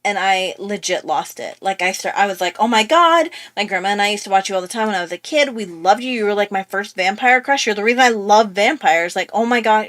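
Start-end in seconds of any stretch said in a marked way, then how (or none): 2.6-9.9 retold fangirling. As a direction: gush like a starstruck fan. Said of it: "I'm fangirling over this guy hard".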